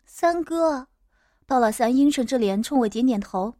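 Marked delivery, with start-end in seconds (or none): 0.1-0.9 higher, drawn-in mild tearful cry; a touch of weeping in a raised pitch; tearful call. A narrator's reading. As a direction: call out as if lightly crying. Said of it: "哭着喊了声".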